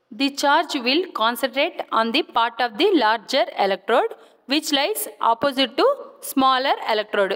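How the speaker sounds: reading notes out slowly, phrase by phrase; slow dictation. Shown as a demonstration. Teacher dictating electrode notes to physio students, slow and measured.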